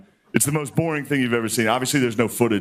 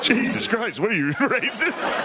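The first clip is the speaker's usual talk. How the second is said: high, slow, laughing disbelief; hammed-up outrage, laughing; mock shocked; 11 semitones higher, slower than usual. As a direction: act appalled through a laugh. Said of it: "And I was like".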